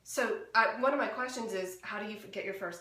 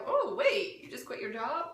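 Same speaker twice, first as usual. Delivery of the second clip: high-pitched mock double take, then laughing; retold shock; 7 semitones higher, slower than usual. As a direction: act out a stunned realization. Retells shock at friend's news.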